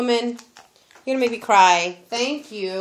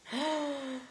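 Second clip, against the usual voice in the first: soft gasp; 5 semitones higher, 11 dB quieter than usual. Real, not acted. Unwrapping a Pandora bracelet.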